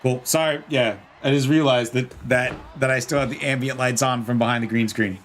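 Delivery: usual speaking voice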